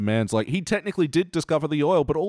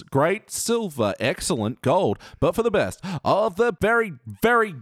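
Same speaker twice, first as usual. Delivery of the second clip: higher-pitched mock-grand announcer patter; show announcer; 6 semitones higher than usual. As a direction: announce the scale with showy relish. Reads out ranking scale as announcer.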